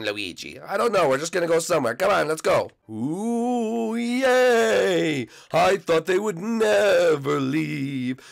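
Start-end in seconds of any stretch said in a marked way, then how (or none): 2.9-8.2 high, slow gloating ghost; sneaky glee. Acted. Blimpy gloating once Luigi leaves, high and slow. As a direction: savour that they are finally gone.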